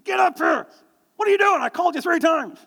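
sharp high shout up the stairs; yelling at son. Retold or imitated. Father shouting for his son to come help, loud and angry. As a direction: bellow for him, fed up. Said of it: "I yelled at Derek".

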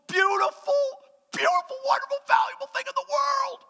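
very high, shouted praise; rapturous. Real, not acted. Preacher crying out how precious a newborn is. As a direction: cry it out in rapture.

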